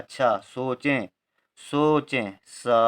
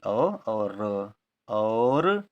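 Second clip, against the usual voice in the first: slow spelling; 2 semitones lower, much slower than usual. Shown as a demonstration. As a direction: lay out the letters gently, one at a time. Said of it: "ओ के बाद में औ आता है".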